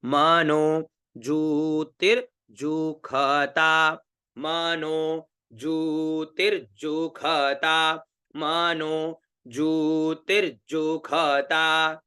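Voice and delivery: मनोज्योतिर्जुखता stretched out, ष said as ख; very slow recitation. Shown as a demonstration. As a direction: chant मनोज्योतिर्जुखता very slowly, with a ख. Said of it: "ध्यान रखिएगा".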